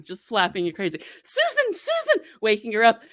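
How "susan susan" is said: high, slow frantic calling of a name; frantic aunt calling. Retold or imitated. Aunt shaking her sister awake, high frantic calling. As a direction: call out to wake her in a flap. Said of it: "So Christine".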